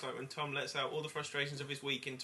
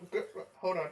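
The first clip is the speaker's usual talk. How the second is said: usual speaking voice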